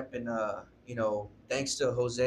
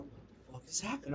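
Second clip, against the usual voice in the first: soft, high bewilderment; retold disbelief under the breath; hushed shock; 4 semitones higher, 10 dB quieter than usual. Teller as the call sinks in, hushed and stunned.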